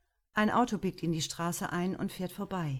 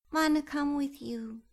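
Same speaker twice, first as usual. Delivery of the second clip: put-on a small girl begging to tag along; child's mumbled plea; 9 semitones higher than usual. A narrator's reading. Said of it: "murmelt Fee".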